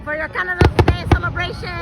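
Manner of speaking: usual speaking voice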